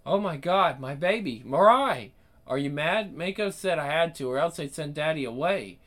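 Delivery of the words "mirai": calling out. Acted. Parent calls out to daughter.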